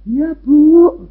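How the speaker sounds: hammed-up obedient maid answering; soft-voiced maid. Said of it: "suaranya si halus". (menirukan pembantu menjawab manis, lebih tinggi dan keras)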